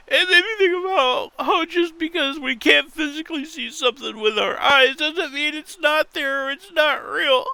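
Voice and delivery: high, strained mock sobbing voice; fake-cry the words. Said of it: "I was trying to do a crying voice".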